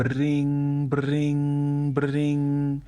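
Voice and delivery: high trilled phone ringing, held long; drawn-out, high-pitched mock telephone ring; imitated old phone ring. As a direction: mimic an old corded phone ringing three times. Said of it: "it sounded like an old phone".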